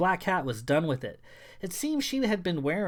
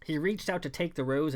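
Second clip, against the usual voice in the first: ordinary delivery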